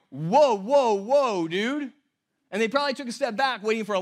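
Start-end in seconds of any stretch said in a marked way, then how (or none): 0.1-1.9 loud startled whoa, then a casual dude; mock alarm at what Jesus said. Crowd reacting to Jesus' claim, startled. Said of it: "the people going".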